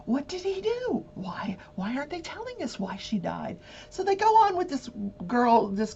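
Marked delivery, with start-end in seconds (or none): 0.0-3.6 higher, hushed wondering juror; imagined juror muttering to herself; puzzled juror. Pretend. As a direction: wonder aloud as a curious juror, trailing into a whisper. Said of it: "I'm sure they're sitting there going".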